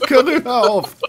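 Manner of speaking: laughing high protest; giggling while telling him to stop. Real, not acted.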